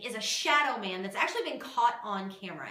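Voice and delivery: ordinary talk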